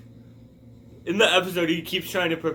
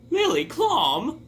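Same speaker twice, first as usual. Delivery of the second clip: mock disbelief; 8 semitones higher, slower than usual. Incredulous reply to alien.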